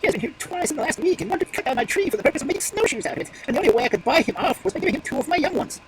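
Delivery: quivering voice. Acted. Magpie telling the crow she lost her young, quivering. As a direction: tell it close to tears. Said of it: "in a quivering voice".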